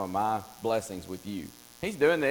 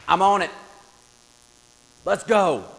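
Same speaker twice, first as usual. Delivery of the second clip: loud, gung-ho soldier's cry; eager shout; 7 semitones higher, 10 dB louder than usual. Pretend. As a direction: shout it like a general ready to charge. Naaman eager, marshaling troops.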